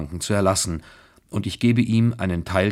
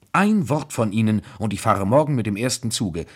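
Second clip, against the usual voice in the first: ordinary delivery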